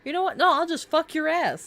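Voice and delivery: lightly voiced sassy threat; tough woman threat. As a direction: sass them like a fighter. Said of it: "women underestimated just being like".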